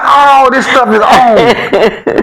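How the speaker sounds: high, slow, laughing friend voice; friend pointing out. Retold or imitated. Friend shows worried buddy the fields, high and slow. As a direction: lightly voice the friend, showing off the fields. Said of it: "He said".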